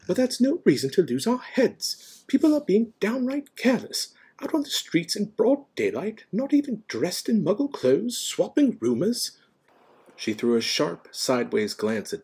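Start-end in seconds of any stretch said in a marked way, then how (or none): 0.1-9.4 high, loud, indignant scolding; irritable. A narrator's reading. McGonagall about people being careless, irritable. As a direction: scold with emphatic disapproval. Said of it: "said Professor McGonagall irritably".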